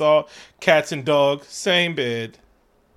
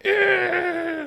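high, drawn-out monster screech; imitated shrill creature cry; firebird screech. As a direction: screech like a giant bird. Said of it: "and he's like, a Firebird / and he's like".

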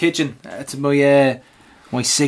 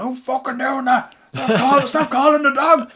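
much higher mock-angry shout, building in volume; exasperated handler; 10 semitones higher than usual. Handler to whoever is distracting his dog, exasperated. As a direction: imitate an annoyed handler yelling, strained and high.

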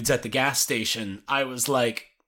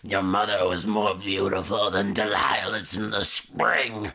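Nick Nolte impression; 8 semitones lower than usual. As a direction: boom it low like Nick Nolte. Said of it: "love impersonating his voice".

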